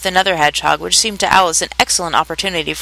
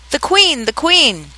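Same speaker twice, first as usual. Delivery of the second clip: mild warning call, pitched up; 8 semitones higher, slower than usual. A narrator's reading. Gardener Five warning the others of the Queen, calling out. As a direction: call it out high, without a full shout. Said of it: "called out".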